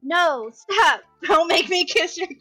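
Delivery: high, loud, half-laughing objection; flustered protest. Acted. Friend telling the teasing boy to stop, flustered protest. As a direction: push back loudly, flustered.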